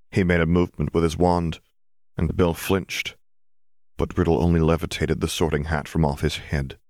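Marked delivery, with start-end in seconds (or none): none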